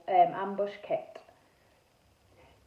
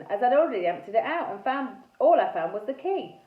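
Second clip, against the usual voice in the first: ordinary delivery, nothing marked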